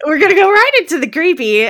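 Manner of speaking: loud, high, fast repeat; excited echo. Real, not acted. Host to co-host, echoing her line loud and fast. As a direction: bounce the line back with glee.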